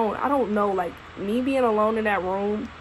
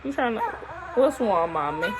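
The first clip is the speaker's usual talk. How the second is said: ordinary talk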